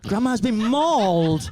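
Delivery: drawn-out disbelieving echo; slow shocked repeat. Real, not acted. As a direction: say it slowly, stunned.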